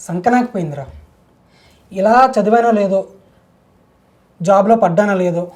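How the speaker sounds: loud, worked-up complaint; exasperated lament. Acted. Man venting to his friend about a joyless life, exasperated. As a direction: vent loudly about a wasted life.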